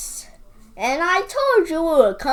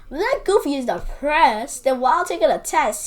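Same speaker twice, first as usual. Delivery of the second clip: ordinary delivery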